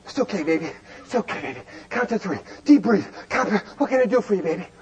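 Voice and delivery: soft, low, half-laughing comfort; soothing husband. Retold or imitated. Husband soothes his wife in labor, soft and low. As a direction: coax her gently like a nervous husband at the bedside. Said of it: "What does Mitchie do?".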